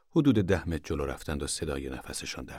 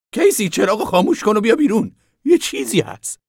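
much higher, louder urgent call; urgent thin man; 12 dB louder than usual. A narrator's reading. The thin man urging Casy outside, much higher and louder. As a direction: call him out fast, alarmed.

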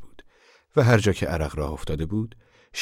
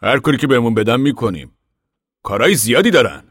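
louder, firm and serious; 6 semitones higher, 8 dB louder than usual. Acted. Papa to the ragged man, loud and serious. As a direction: answer firmly, no joking. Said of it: "با لحنی جدی گفت".

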